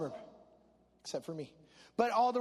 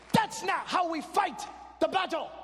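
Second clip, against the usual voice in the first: put-on higher, forceful Spanish-accented English; 8 semitones higher, 5 dB louder than usual. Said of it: "In his broken English".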